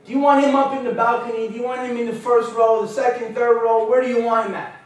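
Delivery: high, loud taunting call to the stands; yelling to the crowd. Retold or imitated. Big wrestler holding him overhead, taunting the crowd, loud and high. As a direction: bellow it out to the whole arena, showing off. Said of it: "he yelled at the people".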